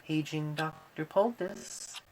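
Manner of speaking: slow, distant PA announcement; mechanical voice. Acted. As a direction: page over the hospital speaker. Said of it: "a mechanical voice rang out".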